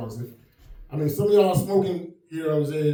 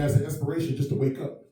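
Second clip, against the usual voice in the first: ordinary talk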